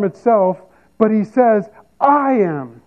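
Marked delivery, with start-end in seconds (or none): 2.0-2.8 "I" stretched out, falling into "am"; emphatic stress on I. Retold or imitated. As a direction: put the weight on "I", then let it fall. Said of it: "He's being emphatic to say".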